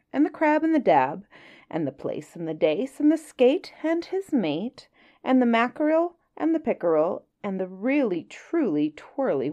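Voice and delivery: lively storybook reading with playful lilt; story narration. A narrator's reading. Reading a Kipling story to listeners, lively storybook voice. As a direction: read it like a bedtime tale to children. Reads Just So story aloud.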